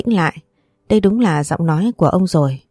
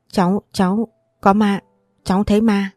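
a touch of panic, stammering and a bit higher; panicked stammer; 3 semitones higher than usual. A narrator's reading. Girl stammering to Aunt Thúy that she saw a ghost, panicky. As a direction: blurt it out in fright, tripping on the words. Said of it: "hốt hoảng kêu lên".

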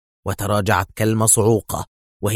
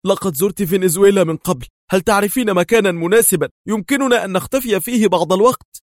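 nervous asking; 9 semitones higher, 4 dB louder than usual. A narrator's reading. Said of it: "وسألها في عصبية".

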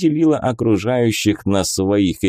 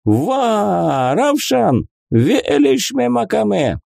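excited gibberish; 11 semitones higher, slower than usual. Acted. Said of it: "Jamshut gibberish excited".

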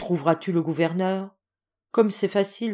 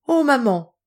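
pained cry; 6 semitones higher, 7 dB louder than usual. A narrator's reading.

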